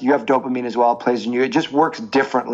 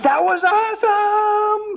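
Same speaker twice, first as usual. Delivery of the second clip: put-on much higher, slow, delighted toddler voice; imitating little girl; slower than usual. Father imitating his toddler daughter after holding baby, high slow squeal. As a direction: squeal it like a thrilled three-year-old. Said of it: "and she goes".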